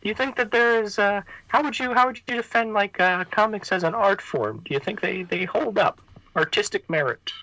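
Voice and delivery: very high squeaky puppet voice; David ventriloquist puppet. Pretend. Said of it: "I'm gonna bring out the, uh, the David ventriloquist puppet".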